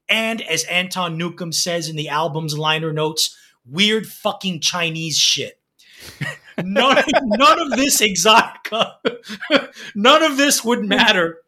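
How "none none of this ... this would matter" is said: higher, laughter breaking into the words; laughing while talking. Real, not acted. Reviewer resuming after quoting crude liner notes, laughing. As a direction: try to carry on through the giggles.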